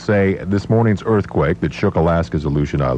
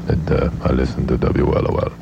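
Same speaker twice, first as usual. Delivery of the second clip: put-on low, droning German-accented statesman; deadpan accented impression, pitched down in a monotone; Henry Kissinger; 3 semitones lower than usual. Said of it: "Henry Kissinger is with us".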